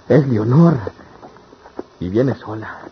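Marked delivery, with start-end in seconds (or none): 0.1-0.8 higher and louder, a sudden burst of joy; light up as you spot her; thrilled gasp of her name; excited recognition. Acted. Sees his beloved approach.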